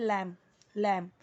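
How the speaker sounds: लैम ending on the m, no ब sound; teacher's correct लैम, the final b left silent. Shown as a demonstration. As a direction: model लैम cleanly, closing on m. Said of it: "ऐसे पूरा ब नहीं बोलना है".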